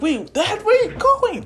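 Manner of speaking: put-on high, loud kid calling after dad; young boy crying out as dad flies off; high child voice. Son calling after his departing dad, high and urgent.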